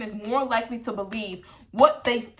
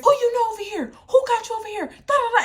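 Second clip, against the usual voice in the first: mock yell; 13 semitones higher, 7 dB louder, faster than usual. Mom's boyfriend yelling at the boy.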